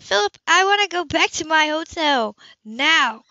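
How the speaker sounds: high, loud, insistent demand; woman demanding Philip take her back to the hotel, furious. A narrator's reading. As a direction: order him to take her back, hitting Now.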